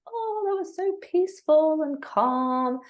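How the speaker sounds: slow airy mock calm; mock blissed-out. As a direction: play the serene classmates, sweetly. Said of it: "everyone came out of the class and was like".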